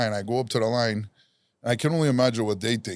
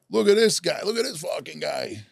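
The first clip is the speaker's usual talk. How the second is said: put-on brash old lady; 7 semitones higher than usual. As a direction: show him off like a tough little old lady. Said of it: "She's, uh, talking like that".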